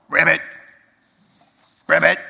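mock frog croak; playful ribbit for the grandchild.

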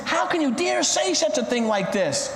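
high-pitched, affronted outcry; indignant cry. Acted. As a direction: cry out as the offended owner, pitch up. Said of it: "cried the Porsche owner".

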